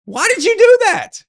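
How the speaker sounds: put-on a kid yelping after a pinch, then complaining; hurt little kid. Little boy to mom after she pinched him, high whiny protest. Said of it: "and I go".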